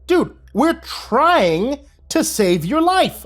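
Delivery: high, slow exasperated plea; exasperated rescuer. A narrator's reading. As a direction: plead in disbelief. Narrator exasperated.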